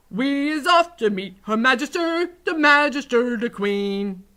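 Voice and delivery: high, loud, proud proclamation; triumphant giant. A narrator's reading. Giant leaves to meet the Queen, loudly triumphant. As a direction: proclaim it grandly as you set off.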